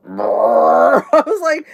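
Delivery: slow, drawn-out laughing words. Real, not acted. Host laughing at her burp story, slow laughing words. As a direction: laugh through the words slowly.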